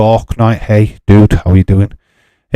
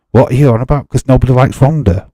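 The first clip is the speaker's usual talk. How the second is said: ordinary talk, nothing marked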